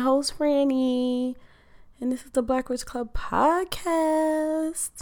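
high, lilting announcer voice on podcast; sing-song intro. Acted. Host announcing the show name to listeners, high sing-song. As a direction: sell the show name like a jingle.

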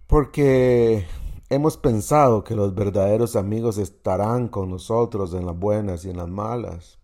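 ordinary talk, nothing marked

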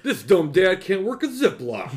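high, sing-song commercial announcer; mock commercial voice. Mocking the commercial dad.

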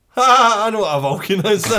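loud, high giggly outburst; re-enacted gleeful cackle, loud and high; acted delayed laugh. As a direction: burst out laughing as you say it. Acting out delayed giggly reaction.

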